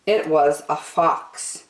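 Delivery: usual speaking voice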